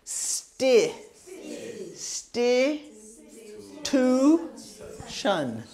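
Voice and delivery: Sti, Tu, Shun each spoken alone with pauses; separate syllables. Shown as a demonstration. Teacher breaking Constitution into syllables for the class. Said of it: "two sounds, S and T".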